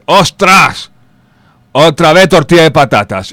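high, loud annoyed cry; mock angry outburst. A builder opening his lunch, loudly annoyed. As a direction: blurt it out, fed up again. Worker finds omelette again.

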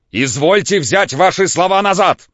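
высоко и громко; требует, надуваясь; гневный крик. A narrator's reading. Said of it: "крикнул Самойленко". (гневный крик, высоко и громко)

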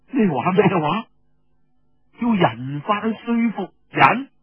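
high, incredulous cry; can't believe his ears; disbelief. Acted. Storyteller voicing Feng Yunshan's shocked disbelief.

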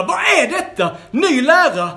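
high, loud, amazed exclaiming; frightened townsfolk asking each other what happened; astonished crowd. A narrator's reading. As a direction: gasp it out in disbelief. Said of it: "frågade varandra".

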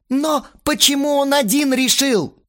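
very high, loud protest; angry yelling. A narrator's reading. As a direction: cry out in outrage. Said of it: "снова заорал Астарот". (орёт возмущённо, срываясь на высокий тон)

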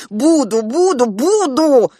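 put-on high, loud, drawn-out childish insistence; defiant yelling. Child contradicting mother about her threat, defiant yell.

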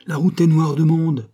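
high, loud, urgent alarm; exclaimed warning. A narrator's reading. Courier warns of the crowded road, exclaiming. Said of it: "s'écria le courrier".

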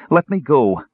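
high, loud protest; crying out. A narrator's reading. Chrysania crying out as he grips her wrist. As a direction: demand it loud and high in outrage. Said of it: "Chrysania cried".

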